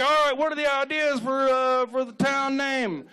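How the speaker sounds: high-pitched caricature of a local official; town meeting chairman. Acted. Chairman asking townsfolk for name ideas, comic caricature.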